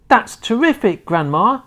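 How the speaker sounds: thrilled at Grandma's trick; excited cry. A narrator's reading. A boy cheering his grandma, excited. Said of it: "George cried".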